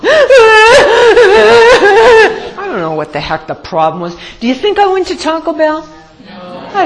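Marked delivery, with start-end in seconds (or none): 0.1-2.5 high, hammed-up sobbing wail; mock wailing. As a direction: bawl comically, long and high. Acts out the bawling.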